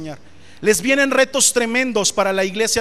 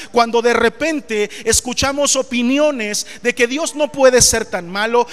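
ordinary talk

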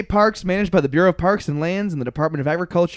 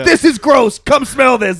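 put-on higher, louder boyish goofing; mock teenage boy; 7 semitones higher, 5 dB louder, slower than usual. Teasing the TV host as a boy, mock teen voice. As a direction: imitate an excited, grossed-out kid. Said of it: "seventeen-year-old boy at heart".

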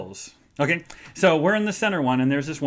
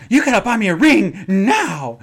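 high, louder demanding whine; demanding friend; 6 semitones higher, 8 dB louder than usual. Retold or imitated. Laura at the fair wanting a ring, whiny demand. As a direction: demand it like a spoiled fiancée.

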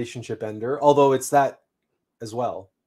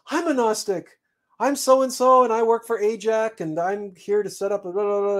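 high, eager chatter, imitating her; excited gushing; 9 semitones higher than usual. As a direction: rattle it off high and bubbly like an excited fan. Woman gushes about herself, retold.

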